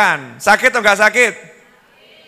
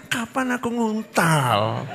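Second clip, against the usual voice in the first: muttered aside; 3 semitones higher, 9 dB quieter, slower than usual. Retold or imitated.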